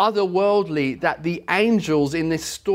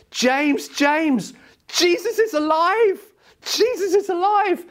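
put-on very high-pitched little girl calling out; excited child; 3 dB louder than usual. Little girl running to tell her friend, excited mock shout. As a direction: imitate a child bursting with news, pitched right up. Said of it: "she just couldn't help but tell someone".

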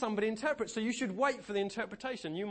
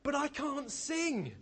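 timid protest; 8 semitones higher, much slower than usual. Retold or imitated. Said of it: "And she says".

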